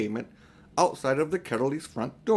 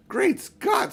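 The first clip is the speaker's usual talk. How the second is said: much higher, louder exclamation; astonished boy; 5 dB louder than usual. A narrator's reading. Diggory exclaims back on the pavement, astonished and high. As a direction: gasp it out in amazement. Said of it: "said Diggory".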